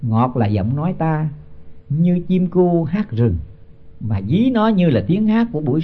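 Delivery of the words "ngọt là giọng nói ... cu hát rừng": slow scripture chant; slow verse recitation. Acted. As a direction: intone the couplet slowly. Repeats verse line, recited.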